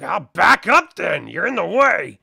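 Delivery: loud, testy orc retort; annoyed orc. Acted. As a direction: snap back at your comrade. Orc grumbles at his comrade.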